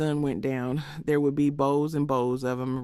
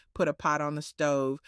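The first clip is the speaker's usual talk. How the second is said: usual speaking voice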